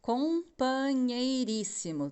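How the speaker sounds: drawn out slowly, word said whole; slow spoken word. Shown as a demonstration. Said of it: "Vou escrever aqui, separando a sílaba". (palavra dita devagar)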